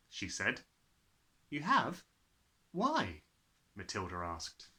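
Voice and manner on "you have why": put-on curious child. Matilda asks.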